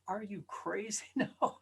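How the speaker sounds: usual speaking voice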